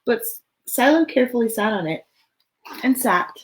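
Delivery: lively, expressive storybook read; reading to children. Acted. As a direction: read it out to a room of little kids. Reads picture book aloud.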